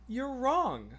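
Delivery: a light teasing ruling; playful verdict. Real, not acted. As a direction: say it lightly, half joking. First take of verdict.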